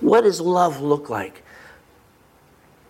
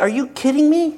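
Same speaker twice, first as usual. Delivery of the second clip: higher-pitched disbelief; incredulous; 10 semitones higher than usual. Retold or imitated. Preacher reacting to what he reads, incredulous. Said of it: "I read this and I'm like".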